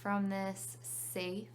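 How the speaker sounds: calm meditation guide with long pauses; unhurried phrases, leaving room to breathe; guided meditation. Real, not acted.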